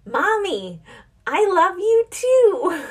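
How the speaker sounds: put-on high, slow little-girl voice; sweet child reply. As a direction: answer back like a sweet five-year-old. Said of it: "she goes".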